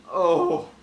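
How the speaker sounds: high, sweet cartoon princess "Oh"; lightly voiced Peach gasp. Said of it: "I hate my Princess Peach voice".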